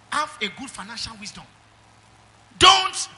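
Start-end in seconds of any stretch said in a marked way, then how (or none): 2.6-3.1 shouted and much higher on DON'T; shouted preacher's warning; loud warning. Real, not acted. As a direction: bark the warning at the flock.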